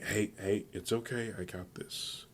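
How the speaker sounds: soft, hushed reassurance; soothing. A narrator's reading. Koe-san calms angry Tomura, soft and soothing. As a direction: settle him down quietly, fading almost to a whisper.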